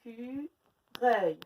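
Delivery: Écureuil spoken slowly in separate beats; slow syllables. Shown as a demonstration. Teacher finding the E sound for pupils, slow. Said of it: "On cherche le son E".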